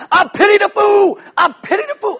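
high shouted Mister T catchphrase, hammed-up; Mister T impression. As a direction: yell it like a kid playing Mister T. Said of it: "in my best Mister T".

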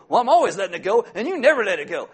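loud, laughing tit-for-tat whine; mock self-righteous complaint. Spouse complaining about always letting it go, loud mocking gripe. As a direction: gripe like a spouse keeping score. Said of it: "keeping track of how many times".

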